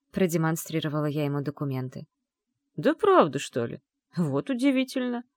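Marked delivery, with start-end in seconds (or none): 2.8-5.2 high-pitched mock amazement; exclaimed surprise. A narrator's reading. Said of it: "воскликнул он". (удивлённый возглас)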